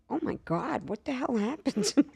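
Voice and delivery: soft shocked mutter; shocked at himself, quietly; mock horror. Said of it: "I'm like".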